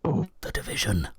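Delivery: breathy slow whisper. Real, not acted. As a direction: whisper it off-mic. Whispered aside into the mic.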